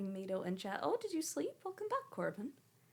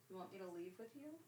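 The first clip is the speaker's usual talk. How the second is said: soft quick pet talk; 12 dB quieter, faster than usual. Real, not acted. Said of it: "faster repeat".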